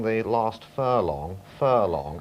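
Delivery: slow, drawn-out commentator voice; Norris McWhirter impression. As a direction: stretch each word like a record-book announcer. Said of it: "talking like this".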